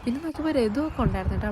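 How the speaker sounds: ordinary talk, nothing marked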